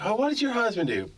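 high, prissy mock question from other parents. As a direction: sneer it like a nosy soccer parent. Mocking soccer parents' question.

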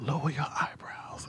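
unvoiced whisper; whispered tip. Stylist whispering a makeup tip to the queen. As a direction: whisper the advice. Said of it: "He said".

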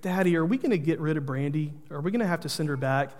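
softly imitating a tearful little girl, quiet; a hint of a worried child near tears. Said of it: "She comes up to me with tears in her eyes".

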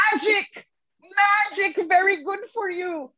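high, clear correction with a Balinese lilt; high Balinese-accented correction; imitating the partner. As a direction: sound out the word carefully, relieved. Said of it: "and then goes".

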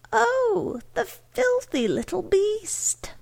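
very high, appalled outcry; spontaneous cry. A narrator's reading. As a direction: cry out in high-pitched disgust. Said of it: "causing Missus Troy to cry spontaneously".

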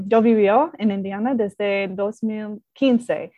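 ordinary talk

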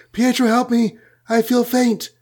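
high-pitched mock plea for help; fainting damsel. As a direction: play a swooning heroine, voice lifted high. Voicing Wanda about to faint.

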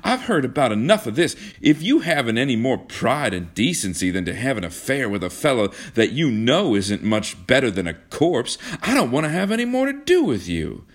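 trembling anger. A narrator's reading. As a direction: tell them off angrily, pitch climbing. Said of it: "voice trembled with anger".

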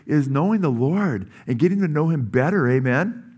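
ordinary delivery

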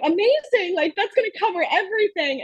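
high, overjoyed naive delight; mock excitement. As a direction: gush as if it were a fortune. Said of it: "I'm like".

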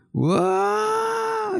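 high, drawn-out mock-excited whoa; imitating excited kindergartners. Kindergartners thrilled at every turn of the bus, excited imitation. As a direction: squeal it like a kid on a bus. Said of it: "they are so excited over every turn".

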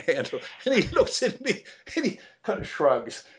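high, laughing while talking; laughing through the words. Real, not acted. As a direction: giggle through it.

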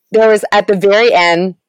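normal talking voice

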